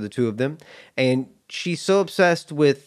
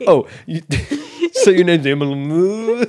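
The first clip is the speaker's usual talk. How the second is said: mangling the name through laughter; mock boy stumbling over "Emily", laughing; laughing impression; 4 semitones higher, 4 dB louder than usual. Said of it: "massacres it".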